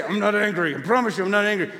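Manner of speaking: mock suppressed anger through gritted teeth; gritted-teeth denial. Preacher imitating someone hiding anger, gritted-teeth denial. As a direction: deny it through clenched teeth, acting. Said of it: "You don't have to grit your teeth".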